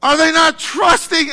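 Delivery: high, loud bewildered angel voice; confused angel. Retold or imitated. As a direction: play a baffled angel in heaven. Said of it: "I would imagine angels are, like, confused".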